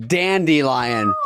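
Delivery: dandelion drawn out slowly as "dandy-lion"; variant pronunciation. Shown as a demonstration. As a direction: announce dandelion slowly with a "dee" in the middle. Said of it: "fifty-seven percent say".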